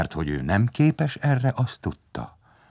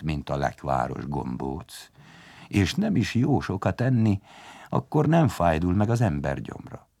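ordinary delivery

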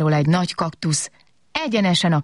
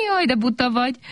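laughing; 7 semitones higher than usual. A narrator's reading. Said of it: "kacagott Kobak".

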